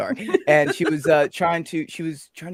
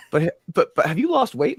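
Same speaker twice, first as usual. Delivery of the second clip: retold coworker; 4 semitones higher, 7 dB louder than usual. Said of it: "I'm like".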